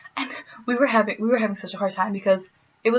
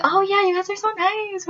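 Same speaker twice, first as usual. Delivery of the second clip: much higher, syrupy mock compliment; gushing praise; 11 semitones higher than usual. Everyone complimenting the Midwesterners, high mock gushing. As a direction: coo it like a fawning stranger.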